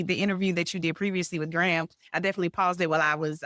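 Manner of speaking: usual speaking voice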